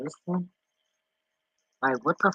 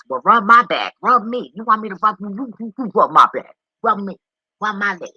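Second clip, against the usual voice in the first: higher, demanding dog voice; pushy pup voice, pitched up; voicing the needy dog; 8 semitones higher, 8 dB louder than usual. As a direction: play the spoiled dog begging for rubs. Voicing demanding dog wanting rubs.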